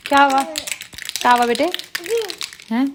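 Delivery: high, loud coaxing call to a little one. Real, not acted. Mother calling to fussing child.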